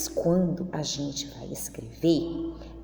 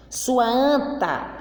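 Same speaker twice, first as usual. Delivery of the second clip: slow, louder drawn-out mock insult; 6 semitones higher, 4 dB louder, much slower than usual. Story character insulting the other, drawn out slowly. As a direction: drag out the name-calling playfully.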